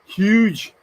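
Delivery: Huge with a bad mock Scottish lilt; bad Scottish accent. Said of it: "my really bad attempt at a Scottish accent".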